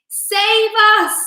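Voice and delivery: high, loud panicked plea; desperate cry. A narrator's reading. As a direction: beg to be saved mid-fall. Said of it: "cried Miss Spider".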